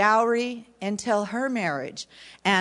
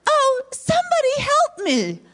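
high desperate cry for help; damsel in distress; 3 dB louder than usual. Acted. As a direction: shriek like a melodrama heroine. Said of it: "So here we go".

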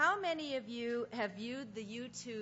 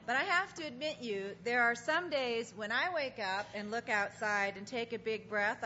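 ordinary delivery

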